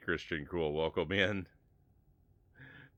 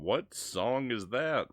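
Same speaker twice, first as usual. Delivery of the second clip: puzzled drawn-out question; 7 semitones higher, much slower than usual. Real, not acted. Puzzled by music in game.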